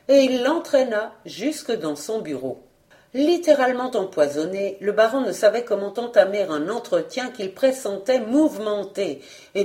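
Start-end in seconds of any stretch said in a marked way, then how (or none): none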